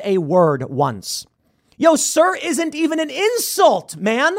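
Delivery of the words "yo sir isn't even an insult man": exasperated. Real, not acted. Host exclaims in disbelief.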